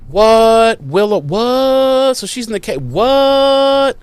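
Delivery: high, slow, baffled cries; mock alarm. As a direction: react in hammed-up shock. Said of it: "So they're like".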